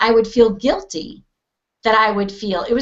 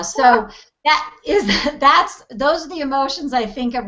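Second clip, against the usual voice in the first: normal talking voice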